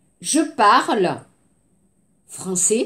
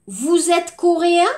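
a raised, questioning vous êtes coréen, pitch climbing on coréen; rising question tune; 5 semitones higher than usual. Shown as a demonstration. Teacher shows students the intonation question, higher rising tune. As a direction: ask it by voice alone, coréen lifting up. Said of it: "la question par intonation".

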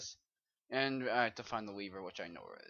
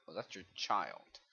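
ordinary talk

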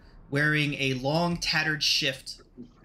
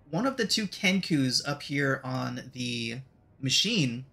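ordinary delivery

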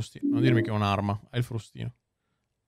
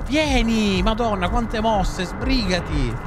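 loud high impatient yelling; explosive italian cursing; 9 semitones higher, 3 dB louder, much slower than usual. Real, not acted. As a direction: bark at her to hurry. Yelling at slow companion.

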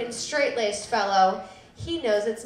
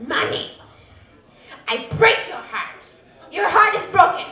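put-on spiteful little boy; 5 semitones higher, 5 dB louder than usual. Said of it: "spiteful little dude".

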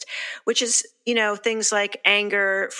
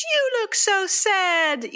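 high, slow, overdone pity; mock pity; 12 semitones higher, much slower than usual. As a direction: sing it out like a cloying sympathiser. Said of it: "we know what we don't want to say".

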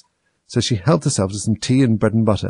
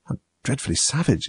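softer, higher appalled exclamation; shocked exclamation; 4 semitones higher, 5 dB quieter than usual. A narrator's reading. Alice hearing of the Queen's order, appalled. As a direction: react in hushed horror. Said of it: "exclaimed Alice".

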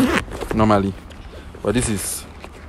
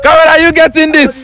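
high, loud yell to the camera; thrilled, raised voice; excited shout; 12 dB louder than usual. Real, not acted. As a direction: shout it in jubilant disbelief.

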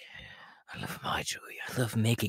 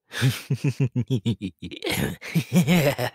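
high, stuttering giggle building louder; clown laughter; 5 semitones higher, 4 dB louder than usual. Acted. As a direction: giggle on and on, unprompted. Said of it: "Why am I always laughing?".

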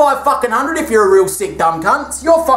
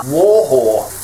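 much lower, punchy insult; deadpan low jab; low growled punchline; 7 semitones lower than usual. Acted. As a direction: drop the voice for the gag.

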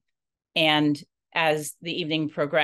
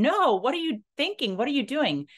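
mock scream; 9 semitones higher than usual. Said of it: "I would be screaming at them saying".

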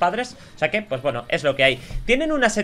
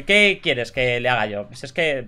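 ordinary talk

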